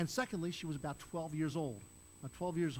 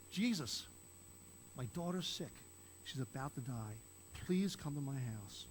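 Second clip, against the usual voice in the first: pleading; 2 semitones lower, 4 dB quieter than usual. Retold or imitated. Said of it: "probably crying".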